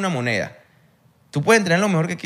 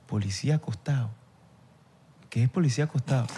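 quiet puzzlement; 7 dB quieter than usual. Retold or imitated. Player retells teammate not knowing his slang, quietly puzzled.

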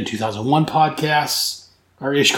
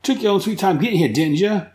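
sarcastic jab; 6 semitones higher than usual. Retold or imitated. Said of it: "in clear Captain Boomerang fashion".

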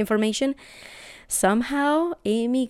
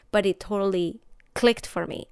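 ordinary talk, nothing marked

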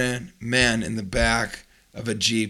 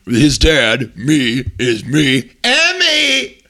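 muddle through it in silly dad voices; mock dad voices; 5 dB louder, much slower than usual. Fathers explaining to teacher in mock voices.

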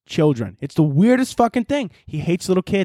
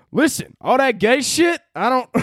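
put-on first stab at a Southern accent, higher-pitched; tentative Southern accent; 6 semitones higher than usual. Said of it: "let me get into my good Southern accent".

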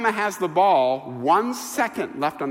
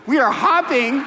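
higher, louder, laughing glee; retold elation; 7 semitones higher, 6 dB louder than usual. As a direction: burst out with giddy joy. Retelling the student's elated hopping.

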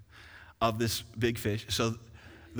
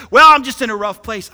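mock excuse; 8 semitones higher, 17 dB louder than usual. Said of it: "use the rough place ... as an excuse to say".

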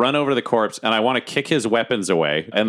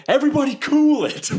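high, put-on calm-down voice; calming the room. Player character calming the panicking goblins. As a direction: settle everyone with a funny voice. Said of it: "And I'll say, like".

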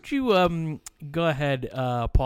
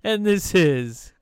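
slow announcer intro; 4 semitones higher, 5 dB louder, slower than usual. Acted.

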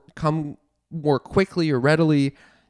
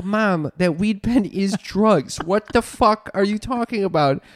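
higher, slowed-down incredulous retort; retold exasperation; 5 semitones higher, slower than usual. Daughter retells setting mom straight about drugs, exasperated. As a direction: spell it out to mom, pitched up. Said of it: "And I go".

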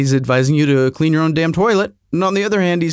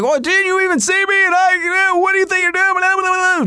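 much higher, mock outraged whine; irate driver. Angry driver accusing him of cutting him off, high mock whine. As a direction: play the furious driver as a squeaky rant. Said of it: "He's like".

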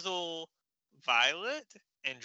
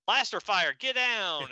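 loud sudden alarm; calling out under blaster fire; urgent warning; 5 semitones higher, 5 dB louder than usual. Retold or imitated. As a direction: shout a warning to duck.

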